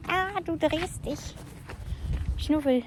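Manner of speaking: high, slow cooing to the dog; baby talk. Acted. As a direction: coo at the puppy. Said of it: "Ich spreche mit ihm immer in Babysprache".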